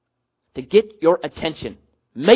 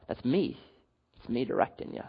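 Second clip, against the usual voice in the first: hushed, much softer and lower; Jesus; 4 semitones lower, 12 dB quieter than usual. Acted. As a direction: drop to a quiet, intimate reveal. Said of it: "Jesus would say to Paul".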